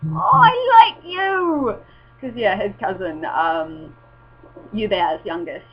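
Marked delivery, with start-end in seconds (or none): 0.1-1.8 put-on high, slow, loud baby-talk coo. Cooing to the happy baby who came up smiling. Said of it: "I was like".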